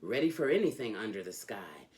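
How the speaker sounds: lively, sing-song reading of a rhyming picture book; animated children's book read-aloud; storybook reading. Acted. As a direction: read it like a bedtime story for a kid. Reads Dr. Seuss aloud.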